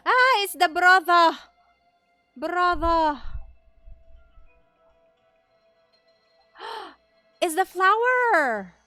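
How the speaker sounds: loud startled gasps and cries; startled exclamations. Real, not acted. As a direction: gasp it out, shocked at each sight. Dream vision, startled discoveries.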